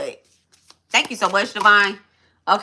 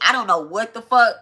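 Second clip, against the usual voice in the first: ordinary delivery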